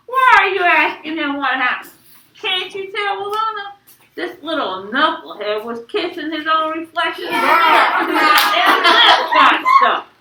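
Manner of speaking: high, loud mocking outburst; hilarious teasing. Dad teases stuck son to Mama, hilarity. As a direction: crow it, bursting with amusement.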